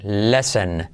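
ordinary talk

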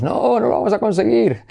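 high despairing cry; despairing quote. Retold or imitated. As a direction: act out giving up. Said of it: "Y decíamos".

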